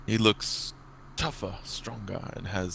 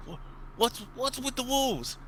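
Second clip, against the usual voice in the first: confused goblin; 14 semitones higher than usual. Acted. A goblin at the fire asking the boss, very high and puzzled. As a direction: squeak out a baffled question.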